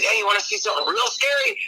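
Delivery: high, eager scare invitation; acted-out impish question; teasing passenger. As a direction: dangle a scary surprise. Repeats Aykroyd's scary invitation.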